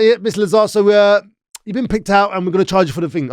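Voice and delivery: lightly put-on official breaking it to a suspect; imitated police officer. Police tell suspect he'll be charged, mock-official.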